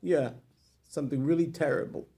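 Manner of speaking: holding back tears. Acted. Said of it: "he's holding back his tears".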